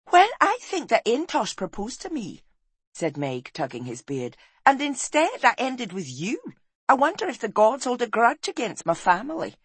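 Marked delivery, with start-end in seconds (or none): 0.1-2.4 high, loud, scolding wife; exasperated wife. Acted. Wife rails at husband after his teasing, exasperated. 4.6-9.6 high, loud, scolding wife; exasperated wife. Acted. Wife rails at husband after his teasing, exasperated.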